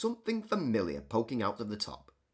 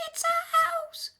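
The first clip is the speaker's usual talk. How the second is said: very high, tiny squeak; squeaky mouse; 3 dB louder than usual. Acted. Mother Mouse tells George her trouble, high squeak. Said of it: "squeaked Mother Mouse".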